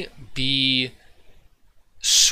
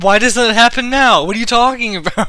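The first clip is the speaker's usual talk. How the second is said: high, loud incredulous laughing; baffled disbelief; 8 semitones higher, 10 dB louder than usual. Real, not acted. Incredulous at rat idea.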